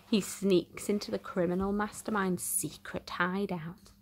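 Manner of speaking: ordinary delivery, nothing marked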